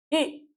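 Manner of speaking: इ said short; short vowel i. Shown as a demonstration. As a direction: give इ one short beat. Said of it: "यहाँ पे". (छोटा इ)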